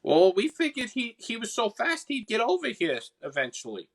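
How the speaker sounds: high-pitched Boston sprite; squeaky-high character with a Boston accent. Acted. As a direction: make excuses in a high Boston voice. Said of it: "I'm stuck in the Boston accent".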